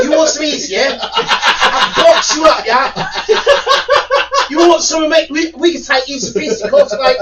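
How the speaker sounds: high, loud mock British accent. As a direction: ham up a British bloke. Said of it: "take a British person serious".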